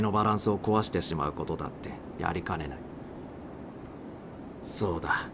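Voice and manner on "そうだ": usual speaking voice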